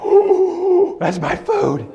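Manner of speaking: delighted dog spotting food; excited dog voice. Retold or imitated. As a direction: squeal like a happy dog. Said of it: "the dog's like".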